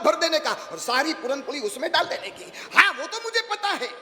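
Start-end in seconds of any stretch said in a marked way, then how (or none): 2.7-3.9 high laughing mock know-it-all; know-it-all bride. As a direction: toss it off high and smug, laughing. Know-it-all bride once more. (हँसती हुई ऊँची आवाज़ में शेखी)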